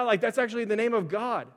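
put-on lifted, eager voice as if explaining to a small child; talking to his son. Father explains God's name to his four-year-old, eager and lifted. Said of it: "I'm like".

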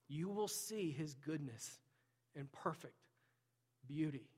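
measured proclamation with long pauses; earnest preaching. Real, not acted. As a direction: proclaim each promise phrase by phrase, weighting the words. Preaches the closing promise.

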